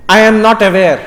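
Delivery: NOT driven hard and loud in "I am not aware."; teacher booms out "I am not aware." as a test; loud demo declaration. Shown as a demonstration. Teacher challenges students, loudly declaring it. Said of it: "Try it".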